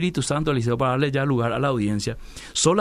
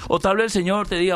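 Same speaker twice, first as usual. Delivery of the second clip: ordinary talk, nothing marked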